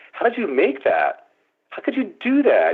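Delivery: high, astonished questions; baffled. Retold or imitated. Adolf pressing Kenneth about the print, astonished. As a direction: press him in disbelief.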